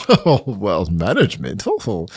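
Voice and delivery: low, coy mock swoon; mock flirty. Telling a friend about hearing of management's interest, mock flirty. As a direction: play it coyly impressed, pitched low. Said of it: "A little flirting going on there".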